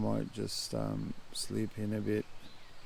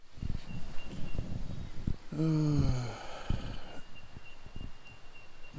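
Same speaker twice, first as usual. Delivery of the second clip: long sigh; 3 semitones higher than usual. Real, not acted.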